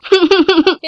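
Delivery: a quick scoffing laugh; short mocking laugh. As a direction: laugh off the threat. Tree laughs at the pests' threats. (ఎగతాళిగా చిన్న నవ్వు)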